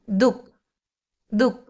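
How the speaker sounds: ദുക് short, with no "ha" after du; silent visarga. Shown as a demonstration. Teacher showing learners the silent visarga, ദുക് with no "ha" inside. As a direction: say ദുക് as "duk", skipping the visarga. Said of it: "അഹ silent ആയതുകൊണ്ടാണ് ദുക്".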